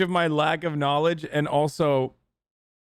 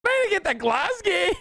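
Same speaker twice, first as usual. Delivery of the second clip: high squeaky imitation, laughing; mock Scottish woman. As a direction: giggle through a high mocking voice. Mimicking the woman from the clip.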